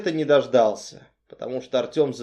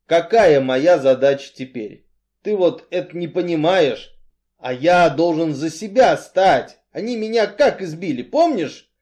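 louder, rising appeal; insistent Artyom, aggrieved; 4 semitones higher, 6 dB louder than usual. A narrator's reading. Strongman reminds Cain of his beating, aggrieved. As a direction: drive the grievance home.

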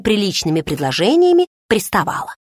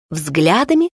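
plain reading voice

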